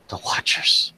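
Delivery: breathless terrified whisper; frightened whisper. Acted. Wife whispering in dread about the Watchers. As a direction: gasp it out in fear. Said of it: "she's like, gasps".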